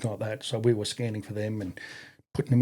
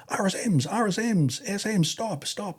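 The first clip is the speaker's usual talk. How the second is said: major calling stop; 10 semitones higher than usual. Retold or imitated. Major breaking up fight.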